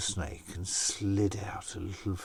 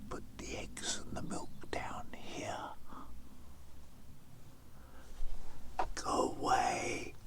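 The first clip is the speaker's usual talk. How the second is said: soft, whispered story voice; hushed telling; 8 dB quieter than usual. A narrator's reading. Storyteller hushing listeners beside the snake's cave, whispering. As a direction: drop to a secret whisper near the cave.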